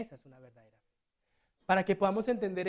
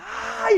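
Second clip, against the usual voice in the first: high, loud acted shriek of panic; mock scream; 9 dB louder than usual. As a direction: let out a panicked squeal. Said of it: "Empieza a gritar".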